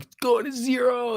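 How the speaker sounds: a high, slow mocking whine of a doom-sayer; mocking shills. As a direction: lampoon the shills in a high, drawn-out voice. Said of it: "They're like".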